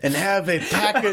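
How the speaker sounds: high put-on Spanish accent through laughter; Jodorowsky's Spanish accent. Adding joke advice for the lawyer, high Spanish accent. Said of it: "we're gonna do the accent".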